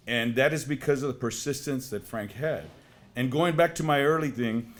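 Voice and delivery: ordinary delivery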